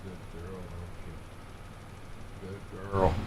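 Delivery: very soft, low murmur to the dog; hushed praise. Real, not acted. Owner murmuring praise to the dog beside him, very softly. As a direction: barely voice it, just for the dog.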